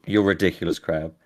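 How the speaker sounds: ordinary talk, nothing marked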